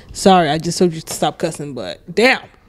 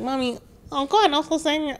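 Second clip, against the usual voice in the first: put-on high childish plea; mock little boy; 9 semitones higher, 4 dB quieter than usual. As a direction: imitate the small brother, higher. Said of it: "you're like".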